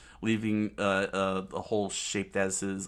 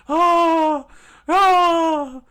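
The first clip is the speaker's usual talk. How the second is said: high, loud cry of pain; pained scream; 15 dB louder than usual. Acted. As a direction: scream as you're dragged. Usopp dragged screaming.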